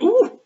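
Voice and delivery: very high, loud squealed ooh. Retold or imitated. Reader recalling reaction to Moby Dick, high squealed ooh. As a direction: let out a delighted-sounding high ooh. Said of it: "I was like".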